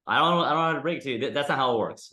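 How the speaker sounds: ordinary talk, nothing marked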